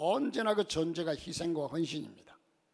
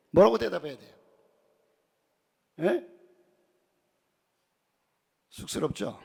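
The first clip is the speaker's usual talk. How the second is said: ordinary talk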